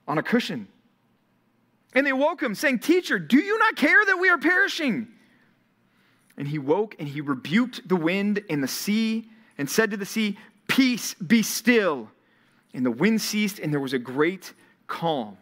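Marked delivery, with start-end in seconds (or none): 1.9-5.1 high, urgent cry of fear; terrified sailors shouting over the storm; panicked disciples. Acted. 10.7-12.1 calm command. Acted. Jesus calming the storm.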